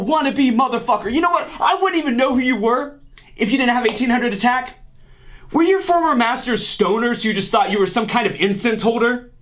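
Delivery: ordinary talk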